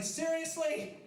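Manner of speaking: wife's incredulous high seriously; mock disbelief.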